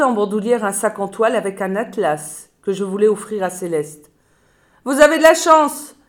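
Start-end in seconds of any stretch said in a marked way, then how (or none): 4.8-6.0 lifted, louder cheerful news; receptionist's upbeat reply; bright announcement. Acted. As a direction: announce it like good luck.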